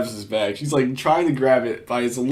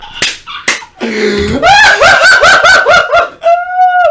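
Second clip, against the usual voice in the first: loud, high burst of laughing; long real belly laugh; uncontrolled laughter; 13 dB louder than usual. Real, not acted. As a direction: crack up helplessly at full volume. Cracks up at the clip.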